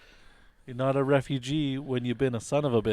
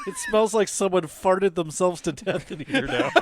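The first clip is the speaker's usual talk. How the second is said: higher, louder through laughter; laughing; 7 semitones higher, 8 dB louder than usual. Real, not acted. Player joking about the durian, laughing. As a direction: say it while laughing.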